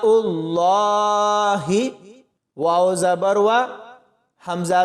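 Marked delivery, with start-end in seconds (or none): 0.0-1.6 very slow Allah with a long falling close; Quranic recitation. Shown as a demonstration. Teacher reciting the joined word for the class, drawn-out recitation. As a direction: stretch the name out reverently as in recitation. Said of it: "اب نا".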